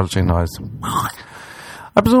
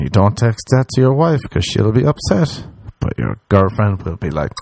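ordinary talk

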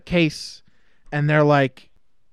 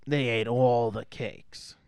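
low, slow and soft, every word weighted; subdued repeat; 5 semitones lower, 7 dB quieter, slower than usual. Retold or imitated. Dwelling on the eaten cakes, subdued slow delivery. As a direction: let it sink down, hushed and slow.

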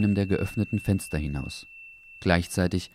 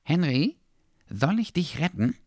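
brave offer; 9 semitones higher than usual. Acted. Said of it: "fragte der kleine Hund jetzt beherzt".

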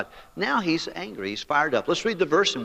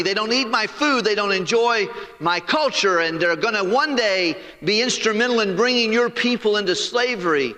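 higher, louder indignant whine at God; Jonah's complaint; 8 semitones higher, 3 dB louder than usual. Acted. Jonah protesting to the Lord, higher indignant complaint. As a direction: complain to God like a sulking prophet.

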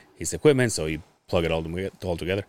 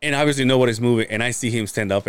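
normal talking voice

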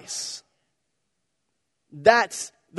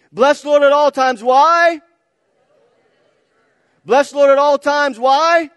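yelled high and loud, really meaning it; preacher leading a loud chant; shouted call; 7 semitones higher, 4 dB louder, slower than usual. Real, not acted. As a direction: shout it back to the church. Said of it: "You guys gotta say it like you mean it".

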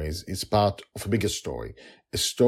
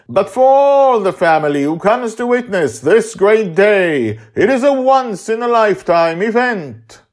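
high, loud, ceremonial proclamation; grand wizard proclaiming; 10 semitones higher, 11 dB louder than usual. Acted. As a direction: announce the ritual like a showman magician. Justin's ceremonial speech.

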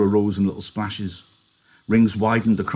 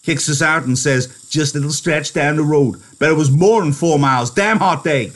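angry rant, building louder and higher; 6 semitones higher, 5 dB louder than usual. Acted. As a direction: rant bitterly, getting more worked up as it goes. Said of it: "He said angrily".

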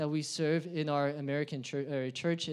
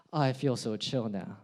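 lazy, low and relaxed; mock chill; 6 semitones lower than usual. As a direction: sink into the couch, totally unbothered.

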